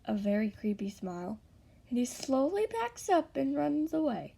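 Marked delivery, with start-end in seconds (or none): none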